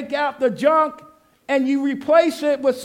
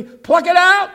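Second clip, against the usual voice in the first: higher and louder call; loud call for the congregation to echo; call to repeat; 6 semitones higher, 9 dB louder, slower than usual. Shown as a demonstration. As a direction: lead the crowd in the line. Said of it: "say it with me".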